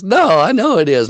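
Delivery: insist, imitating her getting worked up; flipping out. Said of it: "flipping out".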